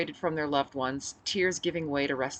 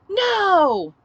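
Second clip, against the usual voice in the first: crowd shout; 12 dB louder than usual. Acted. Crowd answers.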